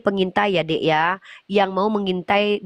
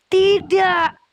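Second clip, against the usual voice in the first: Tidak shouted high and loud; emphatic no; 10 semitones higher, 4 dB louder than usual. Real, not acted. Emphatic preaching denial.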